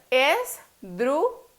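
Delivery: es, drú, ju, las, slow and separated; syllable by syllable. Shown as a demonstration. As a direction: break Esdrújulas into four slow syllables. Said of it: "separémoslas".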